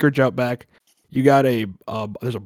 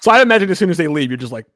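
normal talking voice

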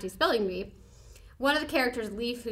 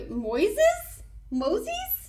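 high, bewildered sounding out; baffled high-pitched spelling out; puzzled; 8 semitones higher, 4 dB louder, slower than usual. Real, not acted. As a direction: spell the word out in confusion. Puzzling over strange word.

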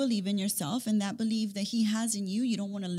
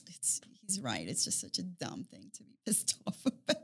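whispered; 4 semitones lower, 7 dB quieter than usual. Retold or imitated. Retold laughing admission.

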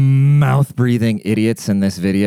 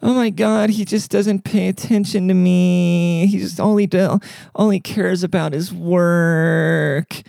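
high, slow whiny wife voice; mocking housewife; 9 semitones higher, slower than usual. Mocking a wife complaining about her husband, high and whiny. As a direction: whine it like a neglected wife. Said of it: "and you go".

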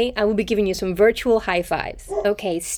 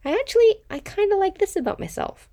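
a much higher, delighted little discovery; pleasantly surprised; 11 semitones higher than usual. Pretend. Coach voices a viewer finding something they like, pleasantly surprised. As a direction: surprise yourself, voice jumping up high. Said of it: "something that you go".

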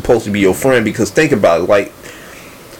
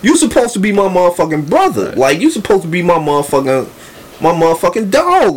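raised-pitch reproach to a friend; indignant reply; 6 semitones higher than usual. Acted. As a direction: act out feeling betrayed by your buddy. Acting out his indignant reply to friend.

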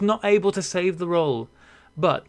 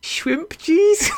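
shrimp cheese squeaked out much higher through laughter; high laughing mispronunciation; laughing attempt. Shown as a demonstration. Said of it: "This is sounding like the Swedish chef".

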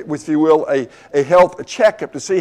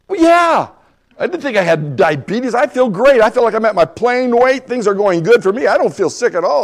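high-pitched protest in retelling; acted-out incredulous patient, voice raised in pitch; indignant disbelief; 6 semitones higher than usual. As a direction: protest that it cannot be true. Retold protest at a diagnosis.

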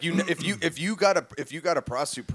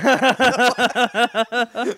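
cracking up mid-sentence; words broken by real laughter; laughing; 7 semitones higher, 10 dB louder, slower than usual. Real, not acted. Laughing at her own joke.

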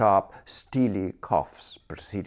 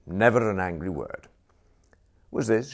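plain reading voice

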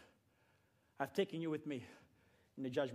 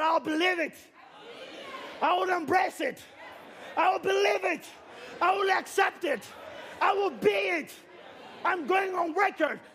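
fervent declaration; 7 semitones higher than usual. Real, not acted. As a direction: proclaim it like crying out belief. Preacher voices a believer's cry.

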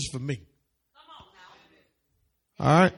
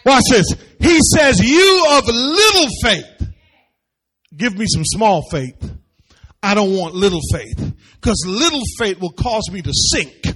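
loud, high-pitched, driving sermon; preaching at full force; 5 semitones higher, 8 dB louder than usual. Real, not acted. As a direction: preach it out hard to the room.

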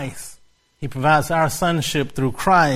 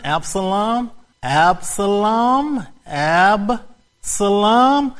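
very slow, drawn-out Absalom; slowly spelled out; 5 semitones higher, much slower than usual. Shown as a demonstration. Teacher slowly saying Absalom to the congregation. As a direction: stretch each syllable of Absalom. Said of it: "I'm gonna slow it down".

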